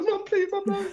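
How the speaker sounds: high, mock frightened begging; high whiny please to mum; pleading child. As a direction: plead like a scared little kid. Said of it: "It's like".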